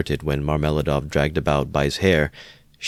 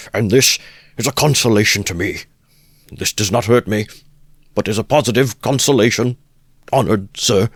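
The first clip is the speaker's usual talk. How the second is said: higher, louder pleading voice; calling out; 5 semitones higher, 5 dB louder than usual. A narrator's reading. Husband calls to the guest while dragged, loud and high. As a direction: call out meekly while dragged by the hair. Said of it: "he called out".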